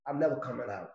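ordinary talk, nothing marked

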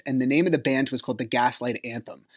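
ordinary delivery

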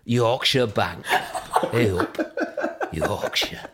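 slow, low Yorkshire advert voice; deep Yorkshire bank voiceover; Yorkshire accent. Acted. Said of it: "you did that voiceover".

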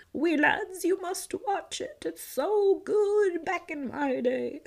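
put-on old-timer voice. To the school kids, a mock grumpy old-timer. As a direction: play a grumpy old-timer lecturing young folks. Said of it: "love when I talk like that".

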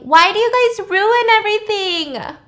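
high, loud, drawn-out mock wail; whiny outburst. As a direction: imitate a man wailing in protest as he flees. Retold man whining while running away.

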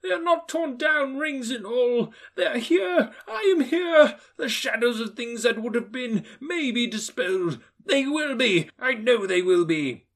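high, loud giddy relief with a laugh; old miser laughing with relief; overjoyed Scrooge. Acted. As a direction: bubble over with joy.

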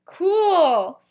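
loud, high, hammed-up acknowledgement; awkward reply. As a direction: overplay a casual one-word answer.

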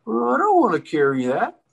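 high, loud mock whine; worried brother. Brother refusing to carry equipment openly, high loud mock protest. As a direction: imitate a reluctant brother protesting. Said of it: "and he goes".

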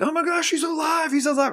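high, astonished cry; mock shocked friends. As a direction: squeal it like they can't believe he's up. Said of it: "they're like".

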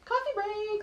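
high sing-song announcement. Real, not acted.

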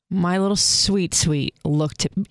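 real lump in the throat; choked up. Real, not acted. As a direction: voice catching with emotion. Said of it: "sorry, I just got choked up".

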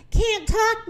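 high, winded gasp of words; mildly acted wheeze; wheezing. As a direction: squeeze it out as if short of breath. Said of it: "Madge wheezed".

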